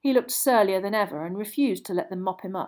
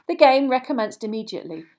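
ordinary delivery, nothing marked

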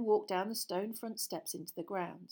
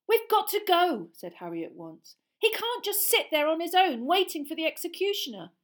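urgent; 10 semitones higher, 9 dB louder than usual. Acted. Harry urgent.

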